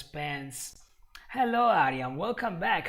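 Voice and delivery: ordinary delivery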